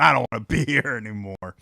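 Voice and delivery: laughing. Retold or imitated. Complaining to mom, laughing.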